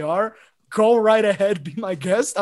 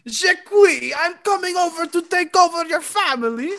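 high, loud fake French accent; a hammed-up French accent, higher and louder; mock French accent; 8 semitones higher, 5 dB louder than usual. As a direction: play a pompous Frenchman barging in. Mock French accent, taking over.

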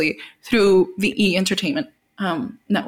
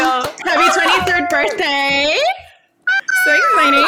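excited birthday cheer; 9 semitones higher than usual. Real, not acted. Co-host wishing her friend happy birthday, excited squeal.

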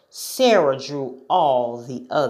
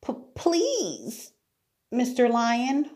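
high, small stammered plea; acted timid girl, stammering; timid whisper; 9 semitones higher, 3 dB quieter than usual. A narrator's reading. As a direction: plead timidly in a little high voice. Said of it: "whispered Sarah".

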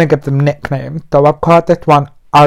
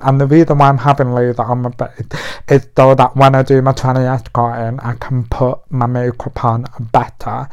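ordinary talk, nothing marked

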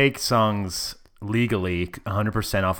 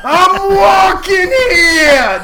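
hammed-up, slow, much louder and higher New York shout; New York yell; 13 dB louder, much slower than usual. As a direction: bellow it like a cabbie cut off in traffic. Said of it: "the stereotype of".

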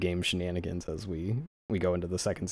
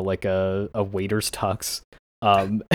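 normal talking voice

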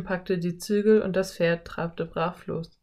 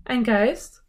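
high, startled little question; 5 semitones higher, 4 dB louder than usual. A narrator's reading. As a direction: wonder in alarm, voice rising. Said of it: "fragte sie erschrocken".

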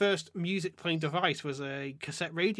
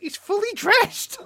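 exasperated squeal; 10 dB louder, slower than usual. Real, not acted. Exasperated at game bug.

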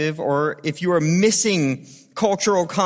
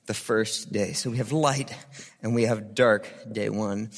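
solemn scripture reading; 6 semitones lower than usual. Acted. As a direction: read it low and reverent, like a poem. Reads Genesis one as poem.

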